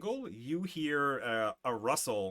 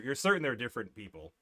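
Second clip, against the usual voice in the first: ordinary talk, nothing marked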